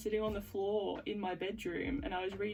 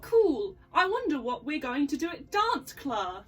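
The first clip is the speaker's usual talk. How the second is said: put-on bright excited kid, louder and higher; excited schoolgirl; 5 semitones higher, 6 dB louder than usual. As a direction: say it like a keen schoolgirl. Acted script, girl character.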